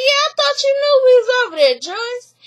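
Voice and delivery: high, fast, innocent-sounding sister; gossipy sister. Retold or imitated. Sister voiced in retold gossip.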